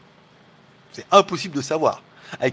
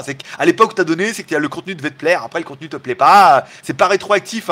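normal talking voice

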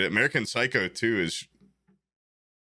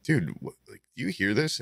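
ordinary talk, nothing marked

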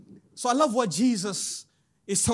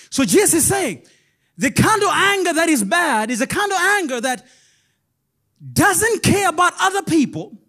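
louder preacher push; preaching at full force; 7 semitones higher, 6 dB louder than usual. Acted. Preacher to congregation, full-force preaching. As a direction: drive it home to the church.

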